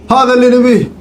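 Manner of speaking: high delighted outburst; excited high cheer. Real, not acted. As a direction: cheer as if you struck gold. Finds the weapons he wanted.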